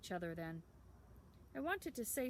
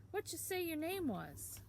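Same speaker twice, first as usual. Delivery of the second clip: put-on much higher, prying boy's voice; curious town boy; 9 semitones higher than usual. A narrator's reading. Town boy quizzing Sam, much higher prying voice. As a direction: quiz the odd stranger.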